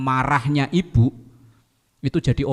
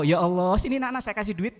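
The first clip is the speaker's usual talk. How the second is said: put-on high, very soft, sugary voice to the children; sweet mock kindness; 6 semitones higher, 6 dB quieter, faster than usual. Mother forgiving children who crashed into her, soft and sugary. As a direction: coo at the kids as if handing them money.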